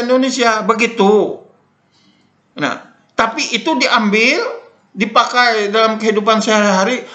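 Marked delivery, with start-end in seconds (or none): none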